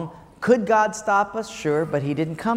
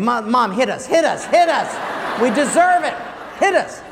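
higher, louder pleading dare; mock taunting kid; 5 semitones higher, 4 dB louder than usual. Boy daring his mother to hit the kids, higher and louder. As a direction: egg her on, begging to be hit.

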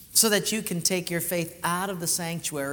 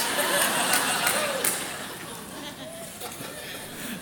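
real laugh. Real, not acted. Laughing at the punchline.

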